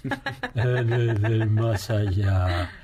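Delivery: creepy voice greeting from the grave; Oscar Sakaguchi cavernous voice. Retold or imitated. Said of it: "esa es la voz cavernosa de Oscar Sakaguchi".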